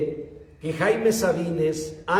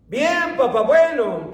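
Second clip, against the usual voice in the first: higher, lightly voiced young student; son answering; 7 semitones higher, 4 dB louder than usual. Sabines as a student answering his father, higher. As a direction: play the weary son brightening up for his dad. Son's tired reply in story.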